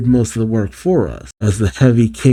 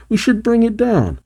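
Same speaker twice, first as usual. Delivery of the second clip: higher-pitched eager proposal; urging; 10 semitones higher than usual. Acted. Tails urging Sonic to sink the warship, urging.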